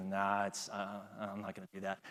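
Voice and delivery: defeated mumble. Retold or imitated. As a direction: mumble it like a beaten man refusing. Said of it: "he's totally defeated".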